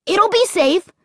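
put-on high, confident boy; eager kid's firm assurance; declaring. A narrator's reading. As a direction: declare it brightly, pitch way up. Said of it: "Luke declared".

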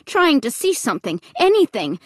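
usual speaking voice

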